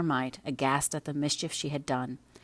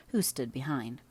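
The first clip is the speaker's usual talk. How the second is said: plain reading voice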